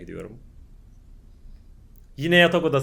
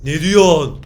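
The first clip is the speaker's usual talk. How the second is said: normal talking voice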